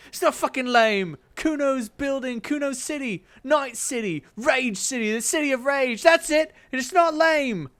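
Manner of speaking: high, loud defensive brat; a kid insisting it is cool; bratty kid Kuno. Acted. Kuno snaps at his friend, high and defensive.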